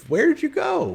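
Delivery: high, loud gnome shout; exasperated little gnome yelling after someone; Bumblebean shouting. Acted. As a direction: call out in a squeaky-high voice. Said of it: "He's, he's shouting".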